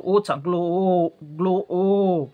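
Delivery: ग्लो and ओ drawn out very slowly, apart; slow classroom breakdown of ग्लो into ओ; slow sound split. Shown as a demonstration. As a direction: stretch ग्लो then hold ओ, teaching pace. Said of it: "ग्लोब म्हणते वेळेस ओचा उच्चार होतो".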